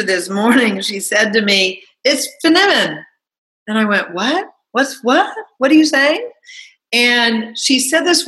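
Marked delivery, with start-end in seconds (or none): 2.0-3.0 lightly voiced producer, pitched higher; a hint of her producer's breezy voice; quoting the producer. Quoting her producer about a puzzling remark, lightly voiced. 4.1-6.3 rising, baffled questions, climbing higher; puzzled, can't catch the word; confused what. Retold or imitated. Retelling her reply to a misheard compliment, mock baffled.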